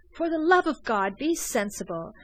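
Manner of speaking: loud desperate outburst; passionate plea. A narrator's reading. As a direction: beg him to see sense. Rattray pleads in passion.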